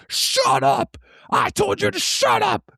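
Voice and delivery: high loud yell at the dog; imitating angry yell. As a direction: scream it at the barking dog. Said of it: "this dude's yelling at the dog".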